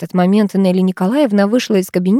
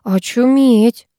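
slow, drawn-out disbelief; higher, stretched-out wonder; stunned mutter; 4 semitones higher, 3 dB louder, much slower than usual. A narrator's reading. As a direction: drag the word out in amazement. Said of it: "пробормотала Карина".

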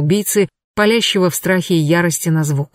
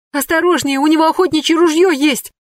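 hysterical cry; 10 semitones higher than usual. A narrator's reading. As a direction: cry out in sheer panic. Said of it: "истерически воскликнула Верушка".

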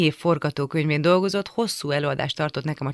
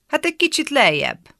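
much higher, put-on voice; laughing reply; 11 semitones higher, 4 dB louder than usual. A narrator's reading. Schoolboy on the bus answering amused, high voice. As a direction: pitch it way up, as if amused.

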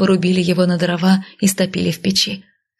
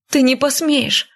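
higher, sharp defiant outburst; higher, indignant protest; defiant cry; 6 semitones higher than usual. A narrator's reading. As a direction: throw it at him, higher. She defies him.

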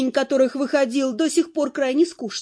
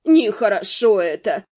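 1 fortune-teller's voice; 5 semitones lower than usual. A narrator's reading.

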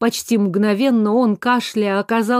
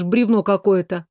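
ordinary delivery, nothing marked

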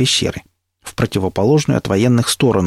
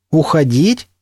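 rising, higher-pitched echo question; surprised; 6 semitones higher, slower than usual. A narrator's reading. Narrator is told to leave, surprised question. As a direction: repeat it in surprise. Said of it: "удивился я".

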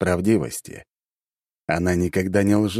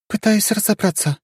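high, modest reply; earnest young heroine; young woman voice. Acted. Ari answers.

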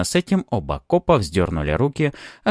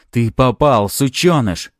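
loud, raised-pitch menace; angry threat; 4 semitones higher, 6 dB louder, slower than usual. A narrator's reading. Cop drawing gun on hero, angry threat. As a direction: spit the threat out loudly.

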